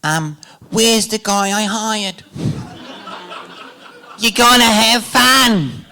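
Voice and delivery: put-on high, drawn-out Australian accent; Baz. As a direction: imitate an Australian director, slow and high-pitched. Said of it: "I'll try to do the accent".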